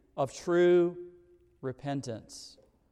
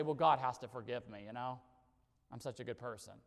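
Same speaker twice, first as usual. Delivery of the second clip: lower, fading to a soft dismissive mumble; mock the careless person who assumes forgiveness; mock presumptuous sinner; 9 semitones lower, 13 dB quieter, faster than usual. Preacher mocking a presumptuous sinner, softly dismissive. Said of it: "we don't just say".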